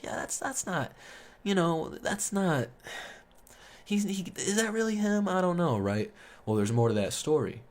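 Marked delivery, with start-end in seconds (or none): 0.0-5.8 high, disbelieving question voice; incredulous question. Retold or imitated. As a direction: pitch it up in disbelief. Friends doubting Cameron's claim.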